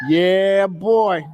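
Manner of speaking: ordinary talk, nothing marked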